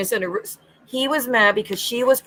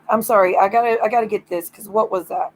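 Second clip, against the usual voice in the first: normal talking voice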